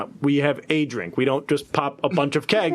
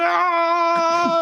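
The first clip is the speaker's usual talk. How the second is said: wild yell; 11 semitones higher than usual. Acted. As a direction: let out a wild party yell. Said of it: "I'm going".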